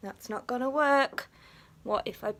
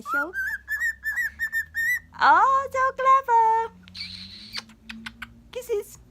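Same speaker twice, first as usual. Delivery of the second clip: high, louder, slow drawn-out cooing; gushing praise; 7 dB louder, much slower than usual. Real, not acted. Owner praising her clever bird, high slow cooing.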